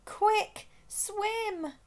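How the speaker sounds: urgent shout. A narrator's reading. Rossi urging Gasper to swim, high urgent shout. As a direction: hurry him into the water. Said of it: "shouted Rossi".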